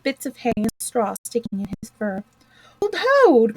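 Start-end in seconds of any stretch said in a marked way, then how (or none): 2.8-3.5 glad high cry of welcome; cried out. A narrator's reading. Mole greeting returned Toad, glad cry. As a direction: Mole cries out in delight. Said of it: "cried the mole".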